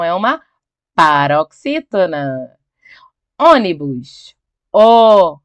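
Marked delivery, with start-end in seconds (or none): 4.7-5.4 "O" sung out loud and long on its own; stressed syllable. Shown as a demonstration. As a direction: isolate "O" as a loud, held vowel. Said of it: "é a sílaba mais forte".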